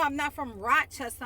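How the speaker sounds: normal talking voice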